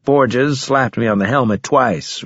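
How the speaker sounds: usual speaking voice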